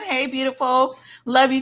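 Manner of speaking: ordinary talk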